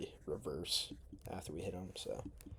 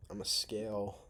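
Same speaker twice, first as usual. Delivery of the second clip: ordinary talk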